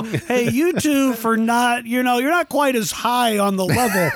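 high-pitched talking down to simpletons; mock condescension. Host imitating co-host belittling the pair, high and condescending. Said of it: "Or simpleton, like, you know".

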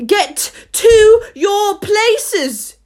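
very slow, high, loud command; drawn-out royal bellow; thunderous shout. A narrator's reading. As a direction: thunder each word as the queen. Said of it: "in a voice of thunder".

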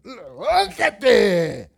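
loud, high, drawn-out shout. Acted. Disciples to the sleeping Lord in the storm, shouting. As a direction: yell it long to wake a sleeper. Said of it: "le estaban gritando".

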